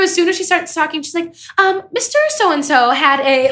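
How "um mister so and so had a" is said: imitating a high, drawn-out voice; slow mock delivery, pitched up high; up-there voice. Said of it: "it is just so up there".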